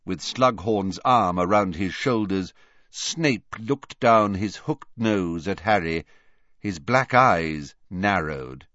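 plain reading voice

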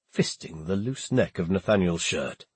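plain reading voice

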